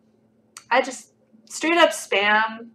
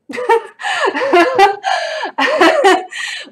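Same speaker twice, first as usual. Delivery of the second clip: high burst of real laughter; loud laugh; 8 dB louder than usual. Real, not acted. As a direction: crack up out loud. Laughing at an absurd comment.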